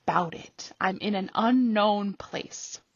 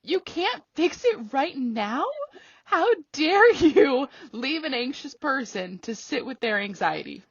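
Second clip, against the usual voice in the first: mock disbelief; 6 semitones higher, 4 dB louder than usual. Repeats the outraged question.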